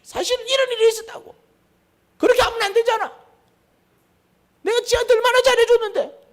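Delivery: high, loud indignant voice; a resentful man explaining why he blew up; aggrieved explanation. Acted. Angry person explains his grievance.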